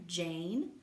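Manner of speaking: ordinary delivery, nothing marked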